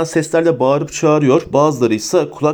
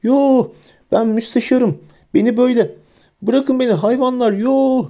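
very high, panicked protest; shrill wail; 10 semitones higher than usual. A narrator's reading. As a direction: wail it high as if being dragged off. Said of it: "diye tiz bir feryat". (tiz, panik içinde feryat)

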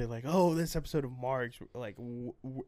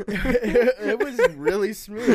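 slow, pained laugh through words; laughing, strained; 9 semitones higher, 10 dB louder, much slower than usual. Real, not acted. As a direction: laugh while still choking. Sarcastic after choking.

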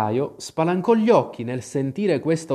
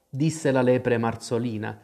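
ordinary delivery, nothing marked

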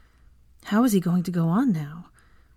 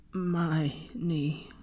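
hoarse croak; 7 dB quieter than usual. A narrator's reading. Draco wakes and croaks to Hermione, hoarse whisper. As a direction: croak it out barely audible. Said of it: "croak out in a hoarse whisper".

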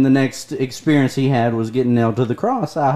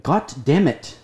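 usual speaking voice

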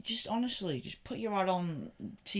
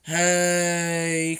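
loud drawn-out greeting; 12 dB louder than usual. Retold or imitated. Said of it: "I was like".

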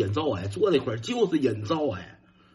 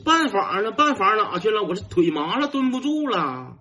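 high, whining yell for help; urgent calling out; 8 semitones higher than usual. Retold or imitated. Boy squatting with numb legs calls his brother, urgent high yell. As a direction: holler for big brother, stretching the last words. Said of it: "我就喊".

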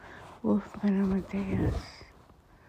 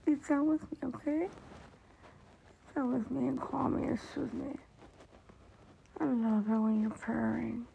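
cooing to a pet; 6 semitones higher, 10 dB quieter than usual. Real, not acted. Owner softly cooing to her little pet. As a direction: coo at her like a beloved pet.

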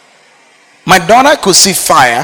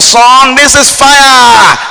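loud, higher, slow booming call of "Son, this is fire"; imagined warning shout; 6 semitones higher, 5 dB louder, much slower than usual. Pretend. Preacher voicing an imagined voice warning a son: slow loud shout. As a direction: boom it out like a voice from above.

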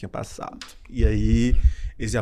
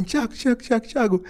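excited friend calling; 8 semitones higher than usual. Retold or imitated. Friend calling out her result.

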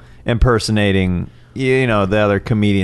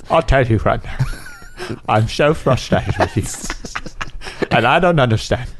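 light Cockney film star voice; Michael Caine voice. Pretend. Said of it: "your Michael Caine".

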